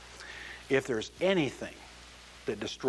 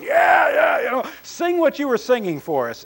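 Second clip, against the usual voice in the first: high, loud mock crowd yelling; crowd cheering; 11 semitones higher, 9 dB louder than usual. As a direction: shout it like an eager studio audience. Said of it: "the audience is going".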